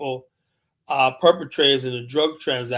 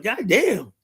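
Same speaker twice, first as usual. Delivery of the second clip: high, drawn-out mock-shocked "God damn"; stunned god damn; 7 semitones higher than usual. To the menacing geese, a high stunned exclaim. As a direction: pitch jumps up in disbelief. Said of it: "I'm like".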